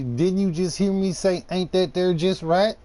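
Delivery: hammed-up irritated country girl repeating herself; sassy Southern girl losing patience; exasperated Southern girl.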